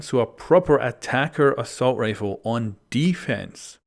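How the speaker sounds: usual speaking voice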